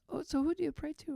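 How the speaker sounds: imitated nurse, pitched high; odd nurse. Odd nurse joins praying patient, imitated in high voice. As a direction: lift the voice into an odd nurse's curious lilt. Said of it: "she had a weird energy to her".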